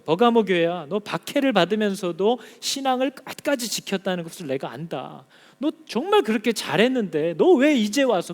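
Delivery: stern reproachful voice of God; God rebuking the church. Acted. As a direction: scold as God, pressing the question.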